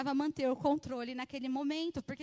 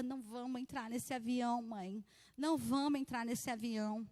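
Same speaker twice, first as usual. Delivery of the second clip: ordinary talk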